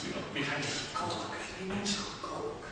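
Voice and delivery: Coke whispered, voiceless, as a discreet correction; whispered aside. Retold or imitated. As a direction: lean in and quietly correct him. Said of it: "And I said".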